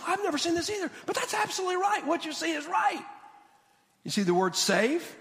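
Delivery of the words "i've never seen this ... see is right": higher, quick amazed professor; a hint of an astonished scholar; lightly voiced excited scholar. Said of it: "he goes".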